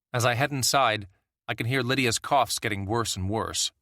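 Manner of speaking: plain reading voice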